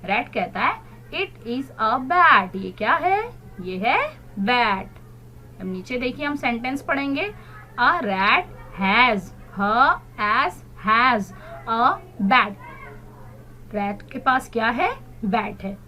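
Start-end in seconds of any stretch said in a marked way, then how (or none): 1.1-2.6 very slow it is a bat; slow model reading. Shown as a demonstration. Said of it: "Repeat after me". 7.8-12.6 very slow spelled-out sentence; slow model reading. Shown as a demonstration. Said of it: "Repeat after me".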